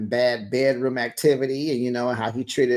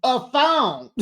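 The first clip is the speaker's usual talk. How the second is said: mock sassy retort; 10 semitones higher than usual. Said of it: "She said".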